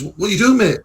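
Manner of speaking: telling someone off in a retold row; retold confrontation. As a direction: pull the other driver up sharply. Confronting a driver in a retold quarrel.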